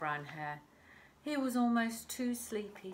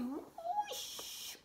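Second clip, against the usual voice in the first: much higher, flying up; swooping whoosh. Real, not acted. Reader swings little hare up for children, swooping. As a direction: sweep the sound up into the air.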